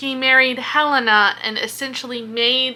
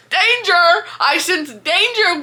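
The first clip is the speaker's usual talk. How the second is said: mock dramatic alarm; 6 semitones higher than usual. Reader echoing the demon's warning, a hammed-up alarm cry. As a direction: sound the alarm like a cartoon. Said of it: "the demon told Hunt he was in danger".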